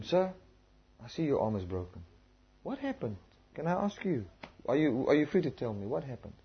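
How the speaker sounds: soft, tender questions to a hurt man; quiet caring voice for a stranger with a broken arm; gentle concern. Retold or imitated. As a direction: ask him softly, with care. Gently asks injured stranger.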